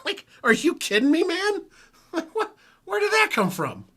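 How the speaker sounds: high, laughing, incredulous outburst; can't believe the weather turned; laughing disbelief. Real, not acted. Telling Nori about waking up to snow, high laughing disbelief.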